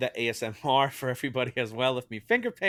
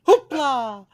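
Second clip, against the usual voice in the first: excited shout; 12 semitones higher, 9 dB louder than usual. Real, not acted. Mocking the service name Hoopla.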